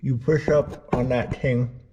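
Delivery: talking around a flashlight in the mouth. Real, not acted. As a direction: speak with something held between the teeth. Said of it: "flashlight in my mouth".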